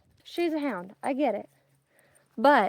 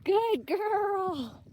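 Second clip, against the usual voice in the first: praising the dog; 8 semitones higher than usual. Real, not acted. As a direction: coo at the dog like a proud owner.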